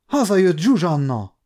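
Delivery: high, cheering announcement; lightly voiced crowd cry; excited shouting. A narrator's reading. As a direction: call out the happy news. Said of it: "kiabálták legalább egyszerre tizen".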